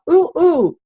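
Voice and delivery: put-on high eager ooh like a kid dying to be called on. Shown as a demonstration. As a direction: beg to be called on. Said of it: "You raise your hand".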